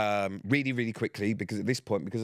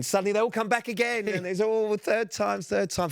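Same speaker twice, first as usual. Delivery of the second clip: exasperated disbelief; 11 semitones higher, 3 dB louder than usual. Retold or imitated. Host retelling the fans' third return, exasperated. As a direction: stretch it out, fed up at a third visit.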